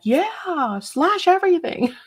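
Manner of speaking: high, gleeful cheer imitating an eager boss lady; gung-ho cheer. Imitating the cool boss lady being first to cheer, gung-ho. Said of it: "She's the first one to be like".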